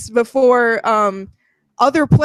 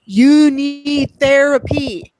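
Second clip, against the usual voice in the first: "need" stretched out, higher and slow; emphatic verdict; 4 semitones higher, 4 dB louder, slower than usual. Real, not acted. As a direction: hammer "You need therapy" slowly, pitched up.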